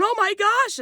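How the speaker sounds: very high-pitched retold panic; panicked cry. Passenger as angry attendant comes at her, panicked cry. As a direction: re-enact a frightened squeal of alarm.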